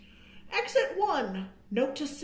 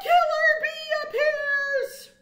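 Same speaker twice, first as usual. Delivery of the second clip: high, loud and slow alarm; squealing fright; alarmed cry; 7 dB louder, slower than usual. A narrator's reading. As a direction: cry out the danger. Sudden danger in story.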